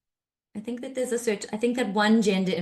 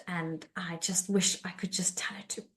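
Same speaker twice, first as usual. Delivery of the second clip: hushed whisper; 2 semitones lower, 7 dB quieter than usual. Real, not acted. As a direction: breathe it out, voiceless. Confessing anger in therapy.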